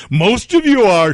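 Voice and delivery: high, slow, loud sneer; mock snooty shout. Storyteller voicing the lady insulting him, loud and sneering. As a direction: spit it out as a snooty put-down.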